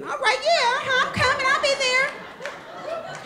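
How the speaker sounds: high, excited squeal of agreement; eager acceptance. Retold or imitated. Storyteller accepting musician's invitation, hammed-up high excitement. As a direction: jump at it in a very high voice, stretching the end.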